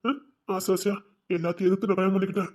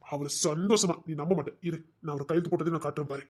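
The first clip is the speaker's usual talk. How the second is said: ordinary talk, nothing marked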